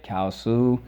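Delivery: ordinary delivery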